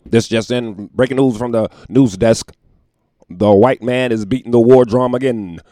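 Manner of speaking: loud announcer newsreader voice; mock news anchor. Mocking the news, loud mock anchor voice. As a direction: break the story like a TV anchor.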